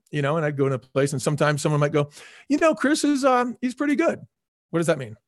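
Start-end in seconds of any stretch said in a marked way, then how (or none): 2.5-4.2 lifted, halting lukewarm praise of the boss; hesitant praise. Retold or imitated. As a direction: hold back, as if unsure about Chris. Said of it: "there's a hesitation there".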